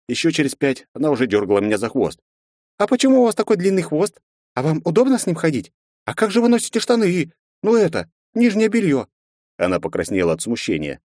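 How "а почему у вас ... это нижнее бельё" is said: put-on high, eager curious child; bubbly girl full of questions; little girl voice. Girl keeps asking questions.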